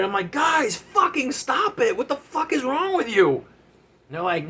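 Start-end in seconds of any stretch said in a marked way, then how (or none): 0.3-3.4 high-pitched frantic outburst at the friends; frantic yell. Retold or imitated. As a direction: beg them to stop, voice cracking high. Yelling at friends to stop.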